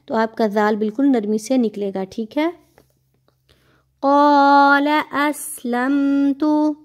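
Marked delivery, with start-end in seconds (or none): 4.0-6.8 slow chanted recitation, heavy qaf; stretched tajweed chant; Quranic recitation. Shown as a demonstration. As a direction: recite slowly and melodically. Recites verse.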